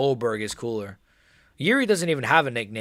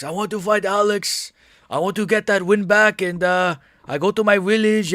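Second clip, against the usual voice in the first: put-on higher accented fighter voice, a little louder; Yuri impression; 6 semitones higher, 4 dB louder than usual. Streamer imitating Yuri calling out Alex after the fight. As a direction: mimic Yuri calling out his rival. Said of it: "what an impression that was".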